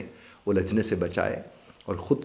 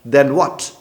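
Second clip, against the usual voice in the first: loud Then what; defiant challenge; 7 semitones higher, 11 dB louder than usual. Shown as a demonstration. Accused man defies the threat, loud. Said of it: "हम कहते".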